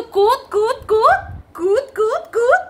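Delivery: "কুত, কুত" high-pitched, each one rising; mock chant of "কুত" with a long oo; mocking repetition. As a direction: mimic the silly "কুত" in high rising chirps. Mocking someone's repeated misspoken word.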